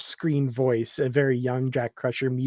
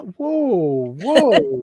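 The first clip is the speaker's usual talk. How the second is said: surprised whoa; 5 semitones higher, 5 dB louder than usual. Real, not acted. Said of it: "Sorry, that was terrible".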